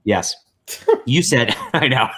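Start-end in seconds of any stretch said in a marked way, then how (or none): none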